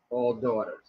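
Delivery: dropped low and soft for all daughters; quiet muttered aside. Shown as a demonstration. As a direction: say all daughters under your breath, to yourself. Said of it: "When I lower my voice".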